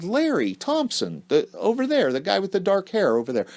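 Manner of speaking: put-on a sweet girl pointing out her date across the room; quoting the girl. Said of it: "And she said".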